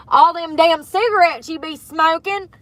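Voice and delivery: a light mock nag; mock scolding. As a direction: tease yourself like a nagging aunt. Scolding herself in other voice.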